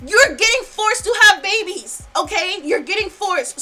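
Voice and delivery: loud angry rant rising to a high shout; furious, emphatic pleading at full volume; real anger. Real, not acted. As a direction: erupt in outrage, then keep pressing hard. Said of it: "but now I'm angry".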